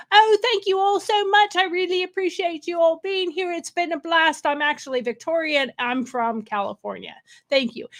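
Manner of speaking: gushing British stage thank-you, dropping into her own voice near the end; Lady Knockers British accent. Shown as a demonstration. Comedian demos her British stage character's thank-you in a British accent. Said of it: "I do a British accent".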